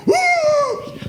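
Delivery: high hooting yelp; a sudden high startled hoot; bear's startled cry. Retold or imitated. Bear running from Dad, a high startled hoot. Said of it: "The bear went".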